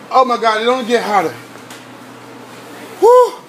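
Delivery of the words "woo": loud, high "woo" at the heat; short piercing whoop from the spice. Real, not acted. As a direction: whoop out the burn. Reacting to the burning spice.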